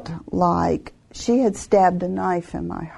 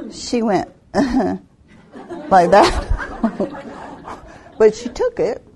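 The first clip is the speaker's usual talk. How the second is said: slow words dissolving into laughter; giggling retelling; laughing; slower than usual. Real, not acted. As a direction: laugh through the memory. Laughing recalling her reaction.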